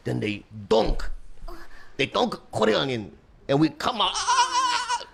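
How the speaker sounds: building from plain to a high laughing "ah"; dad's scary story. Retold or imitated. Dad scaring his eight-year-old at bedtime, hammed-up. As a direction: spook the kid, ending in a mock scream.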